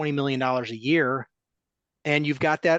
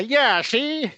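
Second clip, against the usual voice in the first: higher, louder 1950s tough-guy "Yeah, see?"; old gangster accent; 10 semitones higher, 4 dB louder than usual. Pretend. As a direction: toss in a quick Transatlantic gangster tag. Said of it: "gotta throw in a".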